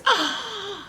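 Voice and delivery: high, distressed little gasp; dramatic high-pitched gasp. Acted. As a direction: gasp like an upset cub. Baby Bear over the broken chair.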